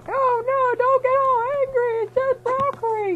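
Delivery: put-on squeaky girlfriend begging him to calm down; high girlfriend voice. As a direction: coax him in a high girly voice. Mary Jane voiced in silly story.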